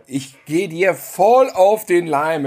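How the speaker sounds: drawn-out, deliberate; slow self-mockery. Real, not acted. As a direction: stretch each word out. Self-mocking admission.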